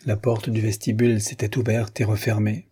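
ordinary delivery

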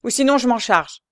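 «Ou sinon» carried on firmly to a falling close; forceful «je m'en charge», falling at the end; completed threat. A narrator's reading. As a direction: finish the threat loud and decided. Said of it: "Jacques continua la phrase interrompue".

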